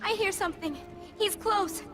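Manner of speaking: put-on high, anxious girl's voice; alarmed warning as danger nears; frightened. Girl warns Kratos someone is close, frightened.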